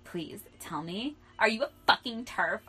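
loud, indignant call-out; mock outrage. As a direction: confront your past self with exaggerated disgust. Mock outrage at their past self.

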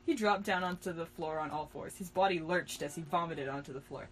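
ordinary delivery, nothing marked

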